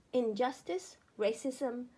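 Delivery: plain reading voice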